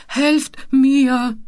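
plaintive call. Acted. Mumpo held over the edge, calling plaintively for help. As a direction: call out piteously. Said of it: "rief Mumpo mit kläglicher Stimme".

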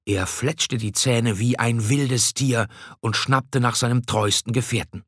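ordinary delivery

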